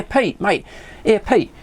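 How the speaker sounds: ordinary talk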